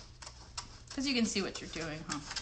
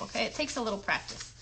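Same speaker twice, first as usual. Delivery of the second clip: normal talking voice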